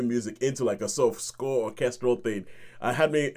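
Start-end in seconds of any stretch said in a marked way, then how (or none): none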